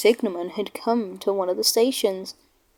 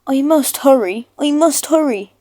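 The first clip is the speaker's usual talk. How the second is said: louder, higher, urgent panting; 5 semitones higher, 6 dB louder than usual. Acted. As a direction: puff it out as he struggles. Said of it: "he panted".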